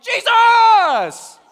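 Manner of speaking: high, drawn-out yell at full volume; screaming. Retold or imitated. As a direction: scream the name like an excited kid. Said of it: "he just starts screaming".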